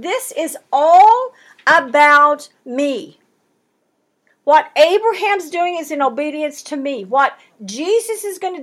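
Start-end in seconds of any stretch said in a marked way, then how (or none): 0.0-3.2 slow, louder, weight on "all"; God insisting it is all about him; emphatic divine voice. Acted. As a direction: lean hard on "all" as God declaring. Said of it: "he's emphasizing".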